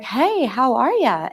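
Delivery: imitated posh English greeting, high and drawn out; mock-posh English accent, singsong and high; poshy English accent. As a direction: lilt it slowly like a posh Englishwoman. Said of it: "in her little poshy accent".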